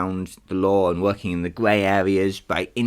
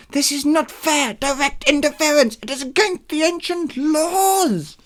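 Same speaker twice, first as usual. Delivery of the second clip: wailing monster; 4 dB louder than usual. Acted. Monster protesting interference against the ancient laws, high wail. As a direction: wail in protest, stretching the last words. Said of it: "The monster wailed".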